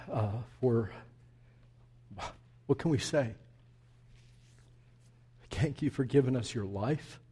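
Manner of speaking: soft, low prayer voice; closing prayer. Acted. Pastor prays over the congregation, soft and low. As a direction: pray humbly and quietly.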